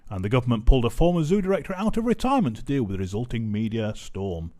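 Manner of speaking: normal talking voice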